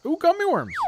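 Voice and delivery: high, loud delighted squeal; excited squeal. Real, not acted. Spots candy mid-game.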